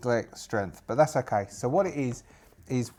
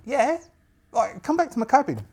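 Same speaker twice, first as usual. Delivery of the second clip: put-on high, brisk young woman's voice; young witch; 12 semitones higher, 6 dB louder than usual. Witch inviting the pirate to her cabin, bright high voice. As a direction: invite them along, pitched up and louder.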